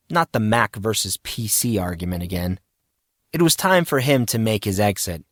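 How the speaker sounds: ordinary delivery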